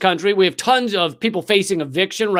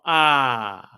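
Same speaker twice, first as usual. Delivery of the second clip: low flat ah; 5 semitones lower than usual. Shown as a demonstration. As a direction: let out a deflated "Ah". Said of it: "more than you go".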